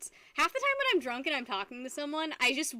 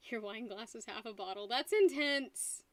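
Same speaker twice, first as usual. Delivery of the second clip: usual speaking voice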